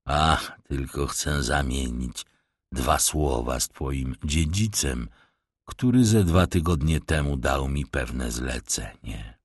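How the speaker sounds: low, soft mock-humble tramp; a sly beggar bowing with false politeness; rough vagrant voice. Vagrant feigning deference to the young master, low and soft. Stranger speaks to the stableboy.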